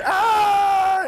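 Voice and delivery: sudden high yelled Ah; a panicked yell as bees attack; mock scream. Storyteller screaming like the fleeing guy.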